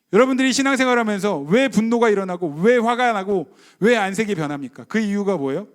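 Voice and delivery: ordinary talk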